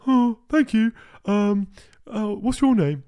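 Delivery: mock posh train enthusiast, high and bright; imitating Francis. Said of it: "and he goes".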